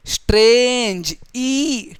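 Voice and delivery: स्ट्रेंज slowly with a long vowel, then ई held; sounding out. Shown as a demonstration. Teacher giving a single-vowel example, स्ट्रेंज drawn out slowly. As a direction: stretch the one vowel in स्ट्रेंज. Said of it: "single vowel sound वाले".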